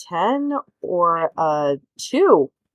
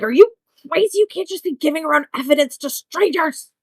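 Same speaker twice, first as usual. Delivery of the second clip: put-on higher, incredulous boy-detective protest; indignant scolding; 10 semitones higher than usual. Boy detective objecting to evidence handed over, indignant. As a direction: object urgently, half in earnest. Blaine scolds, snatching the note.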